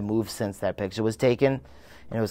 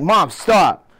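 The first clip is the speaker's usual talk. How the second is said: annoyed; 6 semitones higher, 5 dB louder than usual. Retold or imitated. Angry teen retold quarrel.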